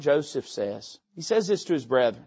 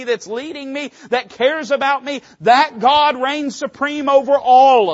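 preaching that builds higher and louder; rising preaching fervor; 4 semitones higher, 4 dB louder than usual. Real, not acted. Preacher to congregation, building to a fervent climax. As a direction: climb toward a triumphant declaration.

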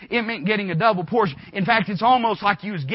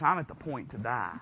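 whiner; 5 semitones lower, 7 dB quieter than usual. Acted. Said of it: "how big of a whiner you are".

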